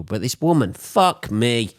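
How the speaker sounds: usual speaking voice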